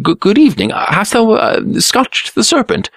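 high, startled stammer. A narrator's reading. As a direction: stammer it out, caught off guard. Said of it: "stammered the Blue Prince, taken aback".

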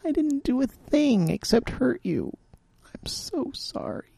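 a hushed tearful woman; tearful apology. A narrator's reading. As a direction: whisper it, near tears. Sharon voiced, crying.

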